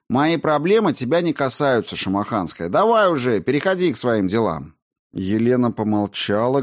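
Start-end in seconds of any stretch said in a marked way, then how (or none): 0.1-4.7 louder, higher, testy retort; irritated brush-off. A narrator's reading. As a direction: cut her off with mild irritation.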